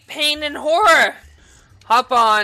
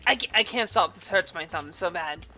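ordinary delivery

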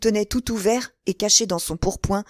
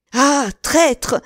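high, loud outcry; betrayed cry; 7 semitones higher, 6 dB louder than usual. A narrator's reading. Stabbed Buckingham cries out at Felton, high and loud. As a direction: cry out at the attacker. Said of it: "cria Buckingham".

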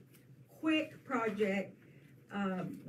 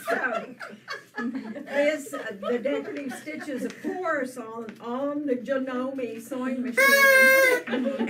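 laughing; 3 semitones higher, 9 dB louder than usual. Real, not acted. Talking through laughter.

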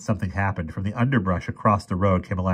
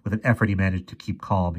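plain reading voice

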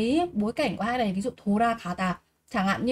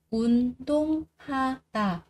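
운동하다 slowly split as 운-동-하-다; syllable by syllable; 2 semitones higher than usual. Shown as a demonstration. Teacher drilling learners on 운동하다, slow and separated. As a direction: say each syllable of 운동하다 apart, drawn out.

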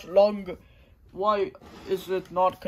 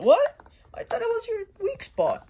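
much higher, disbelieving squeak; shocked. Acted. Fighter finding his attack missed the weak spot, high shocked squeak. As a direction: sound floored the weak spot was wrong.

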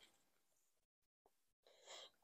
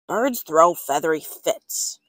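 a hint of a lower, grumpy dad; lightly voiced father; 6 semitones lower than usual. Father refusing a pet, lightly lower dad voice. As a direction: drop the voice a little for Dad. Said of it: "said Father".

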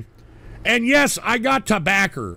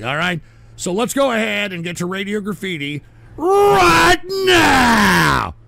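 loud hype call; 4 semitones higher, 6 dB louder than usual. Real, not acted. Hyping the call-in segment.

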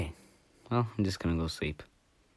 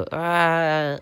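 loud high cry of pain; loud high scream; 9 semitones higher, 8 dB louder than usual. Pretend. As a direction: scream when struck. Character hit, screams.

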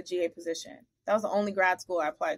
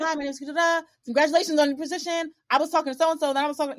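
high and louder, bright formal introduction; eager note voice; 6 semitones higher, 5 dB louder, faster than usual. Retold or imitated. Pitching herself in the note to the new coach, eager. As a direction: read it like a keen applicant's pitch.

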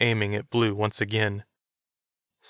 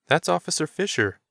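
higher, sudden exclaim; sudden recognition; 8 semitones higher than usual. A narrator's reading. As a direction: blurt it out on recognizing him. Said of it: "Doug suddenly said".

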